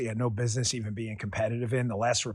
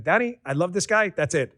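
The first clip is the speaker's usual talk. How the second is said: higher, enthusiastic imitation of a relative; excited mother-in-law; 6 semitones higher, 4 dB louder than usual. Mother-in-law declares her support to family, excited imitation. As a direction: pitch up with decided, retold enthusiasm.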